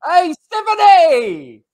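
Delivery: spooky drawn-out call. Acted. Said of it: "What the fuck was that?".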